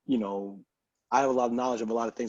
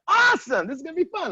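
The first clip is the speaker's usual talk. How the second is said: much higher, loud delight; excited; 8 dB louder than usual. Retold or imitated. Himself reacting to the booking, thrilled. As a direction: squeal the awesome. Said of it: "I was like".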